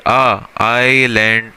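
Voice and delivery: आ stretched long and slow before आइलैंड; demo opening sound. Shown as a demonstration. Teacher isolating the आ sound of आइलैंड, slow. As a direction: draw out the आ of आइलैंड, slowly. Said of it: "इसकी starting की pronunciation क्या है?".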